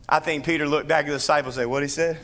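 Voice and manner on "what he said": light Cajun accent with a laugh. Shown as a demonstration. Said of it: "I went all Cajun".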